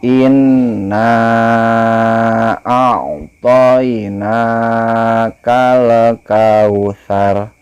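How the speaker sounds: slow, melodic chant with long vowels; Quran recitation. Shown as a demonstration. Teacher models the verse for pupils, slow chant. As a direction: recite slowly, stretching each vowel. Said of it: "simak Bapak membaca surah Al-Kausar".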